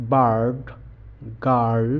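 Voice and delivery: বার্ড and গার্ল with the আ bent toward র; r-coloured vowel. Shown as a demonstration. Teacher showing the r-coloured vowel in examples. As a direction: curl the tongue back on বার্ড, গার্ল. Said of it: "একটু র, র sound এর সঙ্গে".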